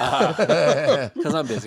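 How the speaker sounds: laughing. Real, not acted. Explaining why he ignored his wife, laughing. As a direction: laugh it out, sheepish.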